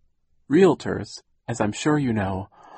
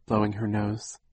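usual speaking voice

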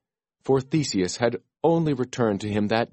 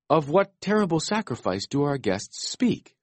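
demanding; 7 semitones higher than usual. A narrator's reading. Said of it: "Theseus demanded".

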